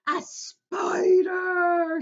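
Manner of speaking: very slow, high, loud shriek; horrified shriek. Retold or imitated. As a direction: scream in disgust at the spider. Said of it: "she cried".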